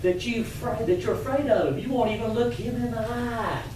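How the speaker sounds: high-pitched, needling gossip; goading tattle. Acted. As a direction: goad the listener in a high voice. Rabbit goads alligator with gossip.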